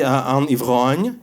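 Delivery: "un ivrogne" said slowly in French pronunciation; French word. Retold or imitated. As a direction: pronounce "un ivrogne" carefully the French way. Teacher gives French word.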